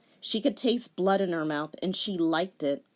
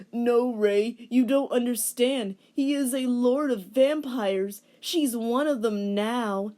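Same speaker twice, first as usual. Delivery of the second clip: grim; 6 semitones higher, 3 dB louder than usual. Acted. Inuyasha tells grim news.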